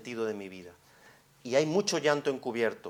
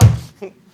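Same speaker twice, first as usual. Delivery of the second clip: laugh; 18 dB louder than usual. Real, not acted. Laugh after grandma bit.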